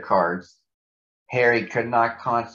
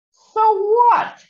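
a very high, loud squeak of protest; squeaky high Harry; 8 dB louder than usual. Acted. Harry challenging Hazel in a tiny high voice. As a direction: pipe up shrill and bold.

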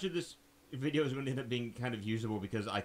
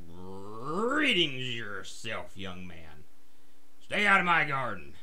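drawn-out growl on the greeting, then louder; growling bear; 3 semitones lower, 4 dB louder, slower than usual. Acted. Bear warns young man off her garden, growling. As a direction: growl it slowly like an annoyed bear. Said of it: "she growls back".

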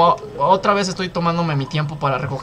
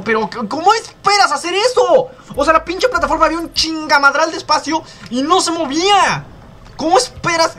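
higher, louder, outraged at the game; exasperated yell at game; 11 semitones higher, 4 dB louder than usual. Real, not acted. Gamer raging at an impossible jump, exasperated yelling. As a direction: shout in disbelief at the platform.

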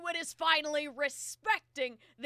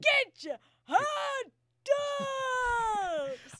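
very slow, high, anguished moan; dying ghost wail; 7 semitones higher, much slower than usual. Acted. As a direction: stretch it into a tormented ghostly cry. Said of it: "It sounds like a ghost dying".

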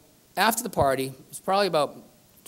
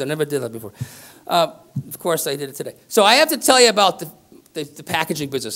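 ordinary talk, nothing marked

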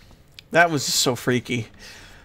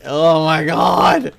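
skin-crawling fright; 7 dB louder, slower than usual. Real, not acted. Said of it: "my skin is just crawling right now".

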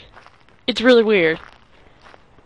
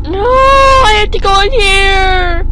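scared crying; 7 semitones higher, 5 dB louder, slower than usual. Real, not acted. Scared in creepy bathroom.

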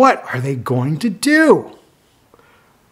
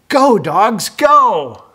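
higher, louder cheer; cheering shout; 6 semitones higher, 4 dB louder, slower than usual. Acted. Cheering the racing dogs on for the child. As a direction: urge the dogs on.

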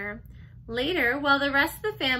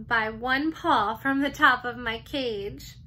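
plain reading voice

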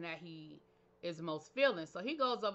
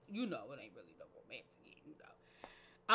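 much lower, imitating a man; imitated man's reply; 10 semitones lower, 6 dB quieter than usual. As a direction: drop way down to mimic his reply. Voicing the man's reply in retelling.

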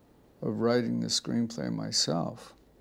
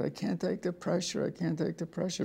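higher-pitched imitating a man falling apart; hammed-up panicky pleading; mock distraught whine; 7 semitones higher than usual. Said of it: "he started crying and screaming".

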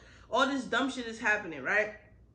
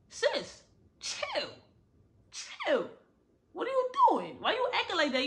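exasperated scolding; 9 semitones higher than usual. Real, not acted. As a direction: talk the character down like a fed-up friend. Scolding the book character directly.

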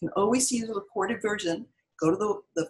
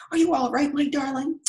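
worried grandpa; 7 semitones higher, 4 dB louder than usual. A narrator's reading. Grandpa worried over wife.

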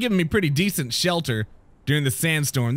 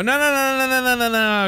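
panicked no no; 8 semitones higher than usual. Real, not acted. Panicked repetition.

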